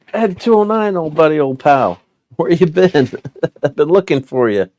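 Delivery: high, loud mock reunion with an old pal; playful greeting. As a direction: hail a long-lost buddy, laughing midway. Greets long-lost toy like old friend.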